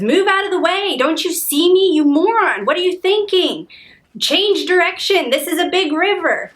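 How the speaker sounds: high, loud indignant yell, acting it out; retold yelling. Man yells at an oncoming boat, imitated angry shouting. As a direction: mimic an angry boatman yelling across water. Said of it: "he starts yelling".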